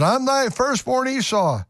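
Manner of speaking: high pleading voice; high eager Esau. Acted. Esau announces himself to his father, high. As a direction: announce yourself proudly.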